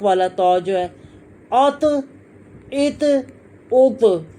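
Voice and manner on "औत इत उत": "औत, इत, उत" with a thick emphatic t echoing as "tuh"; full-mouthed "औत, इत, उत" with released endings; heavy bouncing taw. Shown as a demonstration. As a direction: say "औत, इत, उत" heavy and rebound the t. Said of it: "मोटा पढ़ेंगे".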